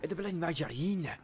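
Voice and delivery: a hint of a broad Scottish accent, lightly put on; Scottish shop worker. Friend retelling the shop, voicing the Scottish dude in Scottish accent. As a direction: answer gruffly as a Scottish worker. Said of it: "A proper Scottish dude".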